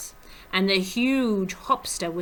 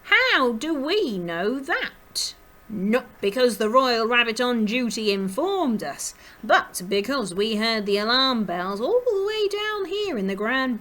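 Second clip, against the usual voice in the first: high, loud scolding character voice; indignant storybook rabbit, voice swooping up; agitated jackrabbit; 4 semitones higher, 6 dB louder than usual. A narrator's reading. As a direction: scold the guard with theatrical agitation. Agitated jackrabbit scolds the sleepy guard.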